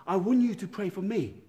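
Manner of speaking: a person in the dream asks the priest for prayer, lightly voiced; pretend request in a dream. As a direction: voice the friend asking for prayer, lifted and loud. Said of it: "and then may say".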